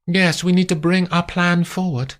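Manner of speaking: high, brisk; high, businesslike; Amandine. Acted. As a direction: announce it to the sleepy maid. Amandine instructing.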